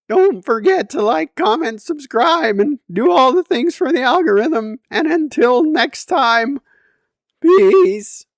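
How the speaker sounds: high, loud sign-off pitch; YouTube outro. Acted. Vlogger closes the video asking viewers to subscribe, loud and upbeat. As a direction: hype up the subscribe plea.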